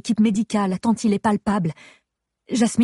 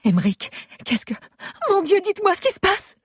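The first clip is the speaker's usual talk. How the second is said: panicked, voice climbing; 12 semitones higher than usual. Acted. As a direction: pitch jumps way up in panic, high and frantic. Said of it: "Ma voix a grimpé d'une octave".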